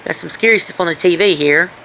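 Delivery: ordinary talk, nothing marked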